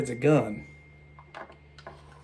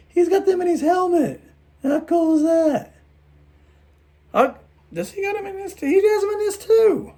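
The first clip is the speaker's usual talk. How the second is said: much higher, squeaky put-on voice; odd squeaky voice; 5 dB louder than usual. Real, not acted. Collector gushing over helmet detail, in high squeaky voice. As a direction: slip into a silly high squeak while showing off the toy. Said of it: "I don't know why I'm talking like that".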